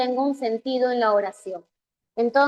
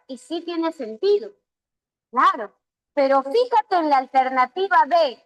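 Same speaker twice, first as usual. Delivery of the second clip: ordinary talk, nothing marked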